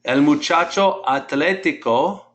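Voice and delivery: normal talking voice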